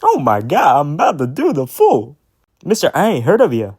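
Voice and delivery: high, swooping rap mimicry; imitating the rapper. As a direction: mimic a rap verse with jumping pitch. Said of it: "when he goes".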